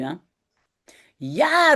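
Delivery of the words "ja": calling out. A narrator's reading. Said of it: "roept Janneke".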